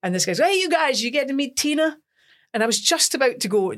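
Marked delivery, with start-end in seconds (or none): 0.4-2.0 high, chirpy press caller; mock-eager phone interviewer; imitated interviewer.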